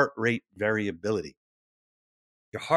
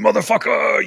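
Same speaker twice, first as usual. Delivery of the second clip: loud angry curse; loud mock shout; mock yell; 8 semitones higher, 8 dB louder than usual. Retold yelling at someone.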